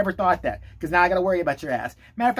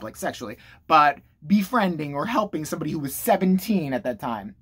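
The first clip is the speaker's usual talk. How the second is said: ordinary delivery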